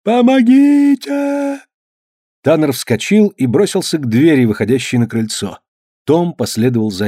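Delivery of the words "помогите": high, drawn-out cries of help; distant cry for help. Acted. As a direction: call for help from far off in a storm. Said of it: "врезался крик".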